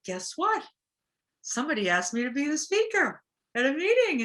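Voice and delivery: high, loud excited announcement. Retold or imitated. Newcomer tells sponsor she was asked to speak, excited. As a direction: burst out with the news. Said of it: "I was like".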